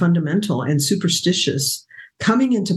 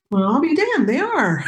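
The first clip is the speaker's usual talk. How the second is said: high-pitched, laughing astonishment; retold amazement; 8 semitones higher than usual. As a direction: imitate a man's amused disbelief. Husband's amazed reply retold.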